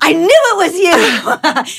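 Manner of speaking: high, slow, awed delight; starstruck fan. Retold or imitated. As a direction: draw it out in awe. Girl after reveal.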